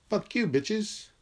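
high-pitched imagined gloating; mock triumph. Player coming up with the ball, mock triumph. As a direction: pitch it up, crowing without shouting.

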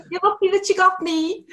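hammed-up Liverpool mum telling off naughty kids; Liverpool accent. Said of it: "Liverpool character".

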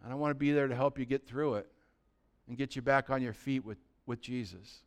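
soft, low, reassuring; quiet, supportive word to a brother; brotherly encouragement. Acted. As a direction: come alongside him gently. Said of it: "but just come to 'em like".

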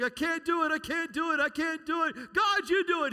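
high, loud, desperate cry to God; mock frustrated pleading. Preacher acting out a believer begging God, high loud desperate plea. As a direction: wail it like giving up.

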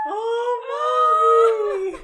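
high, loud mock sob; mock tearful. Retelling seeing her mom, high teary cry. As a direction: cry out like a touched child. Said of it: "And I'm just like".

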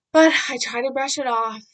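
loud, forceful "brush it off"; bursting out with strong emphasis; emphatic brush-off. Real, not acted. First take of repeated phrase.

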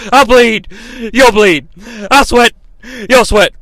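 loud wrestler chant in short bursts; Ric Flair. Acted. Wrestler vows blood and sweat, loud promo. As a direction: shout each pledge as its own punch. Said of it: "Ric Flair is gonna bleed".

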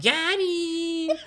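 put-on squeaky high little girl gloating; high tiny voice, triumphant; helium voice. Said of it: "Talking about".

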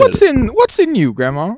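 high, loud cartoon dog voice; mock dog questioning its owner; talking dog. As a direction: start way up high as a puzzled dog, then drop. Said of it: "and the dog's like".

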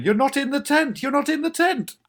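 high, loud frantic scream; retold screaming. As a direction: scream it out in exasperation, pitched right up. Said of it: "I do remember screaming".

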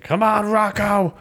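high, slow, nagging impression of Paulie; impatient Paulie. Paulie nagging Rocky at the grave, high and slow. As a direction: whine at Rocky to hurry up and leave. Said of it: "And he's just like".

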